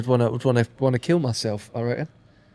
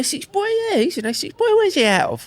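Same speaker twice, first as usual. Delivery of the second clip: high, mocking Essex geezer voice; essex accent; 11 semitones higher than usual. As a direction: ham up a nosy Essex geezer. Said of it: "imitating people asking".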